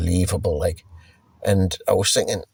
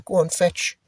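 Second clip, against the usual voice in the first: mock dog owner; 8 semitones higher than usual. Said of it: "they'll say".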